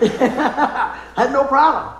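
laughing out loud, high and loud; real laugh. Real, not acted. Dad joking about his son buying it, laughing. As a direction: break into a big laugh, then talk through it.

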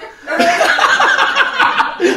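long high real laugh; big laugh. Real, not acted. Telling the congregation his hospital story, a loud high laugh.

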